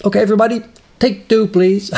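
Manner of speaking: high, projected announcement to a crew; director calling out. Retold or imitated. Director to film crew before a retake, calling out.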